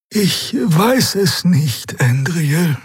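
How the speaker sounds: high, slow, hesitant bird voice; high-pitched beaked character, doubtful; unsure bird creature. A narrator's reading. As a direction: answer uncertainly, very high and drawn-out. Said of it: "Xea senkte unsicher den Schnabel".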